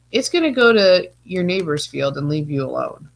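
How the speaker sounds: ordinary talk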